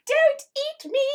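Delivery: high, squeaky mock panic; cute fish crying for mercy; scared little fish. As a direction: plead like a tiny cartoon fish. Drawn fish begging not eaten.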